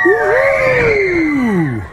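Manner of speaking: high, loud whoop of delight; joyful shout. Acted. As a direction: shout like riding the coaster. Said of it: "råber alle i rutsjebanen".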